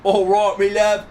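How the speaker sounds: loud, high, cheeky Cockney; Cockney accent. Acted. Witness answering the judge's correction, loud Cockney. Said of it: "this is the Cockney accent here".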